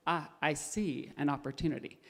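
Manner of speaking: a crafty fox murmuring to himself; the hungry fox sees his chance, low and soft; scheming fox. Acted.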